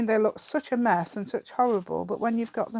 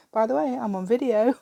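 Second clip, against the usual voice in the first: lightly acted, louder and higher announcement; retold warning; 4 semitones higher, 7 dB louder than usual. Vlogger retelling telling husband she's filming, mock announcing. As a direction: call it out as if retelling your own warning. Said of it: "I told him".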